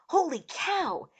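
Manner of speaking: high, slow, drawn-out exclamation; alarmed exclamation. A narrator's reading. Eric sees lizards diving, high alarmed cry. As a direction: cry out in shock.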